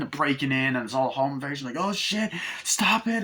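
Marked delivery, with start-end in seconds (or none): 1.8-3.2 panicked plea. Acted. Family being attacked.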